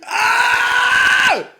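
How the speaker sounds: high shrieked ah; disbelieving shriek. Real, not acted. Outraged at the advert, shrieking.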